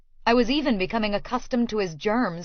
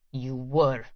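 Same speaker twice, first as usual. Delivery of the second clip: low curt Russian man; 7 semitones lower, 3 dB quieter than usual. Acted. Quarrel, his low voice.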